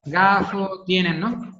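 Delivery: ordinary talk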